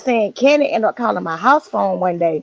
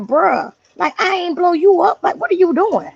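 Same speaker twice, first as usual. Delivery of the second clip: a touch of mad disbelief, higher; imitating his own irritated outburst; mild retold anger; 8 semitones higher than usual. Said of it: "And I got mad".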